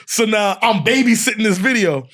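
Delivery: ordinary talk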